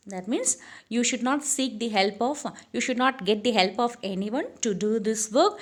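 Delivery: ordinary talk, nothing marked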